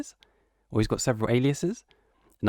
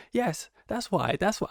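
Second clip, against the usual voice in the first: mock British accent; 10 semitones higher than usual. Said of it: "That British accent".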